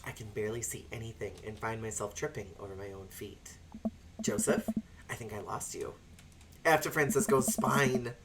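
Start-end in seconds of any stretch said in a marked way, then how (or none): 0.0-3.6 soft unvoiced narration; whispered. Narrating through dark church corridors, whispered. 4.2-5.9 soft whispered call into the dark. Pretend. Calling to Joseph in dark halls, whispered.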